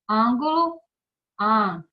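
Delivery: "ângulo" loud, then "an" alone, punched; stressed syllable shown. Shown as a demonstration. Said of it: "a sílaba mais forte é an".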